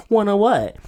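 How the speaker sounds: high, loud affronted comeback; acted indignant question; mock affronted. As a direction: throw it back in mock offense. Acts affronted reply to the stereotype.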